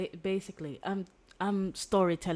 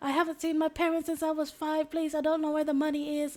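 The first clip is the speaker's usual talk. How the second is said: much higher, loud, rushed panicked begging; desperate pleading; 10 semitones higher, 5 dB louder, much faster than usual. Acted. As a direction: beg fast and high, terrified of the captors. Captive girl begs interrogators.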